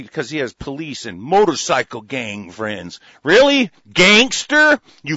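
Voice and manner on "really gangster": loud, high, scornful disbelief; sarcastic disbelief. Real, not acted. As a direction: sneer it loudly, mocking his toughness. Mocking a man's gangster friends.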